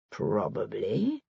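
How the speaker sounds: slow, soft, hesitant probably; slowly. A narrator's reading. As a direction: draw it out, unsure where she's going. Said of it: "he said slowly".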